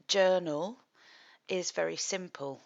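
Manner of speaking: ordinary delivery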